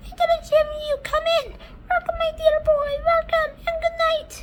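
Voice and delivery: high, fast sweet voice; glowworm voice. Acted. Glowworm welcoming James before bed, high fast voice. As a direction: chirp the welcome quickly.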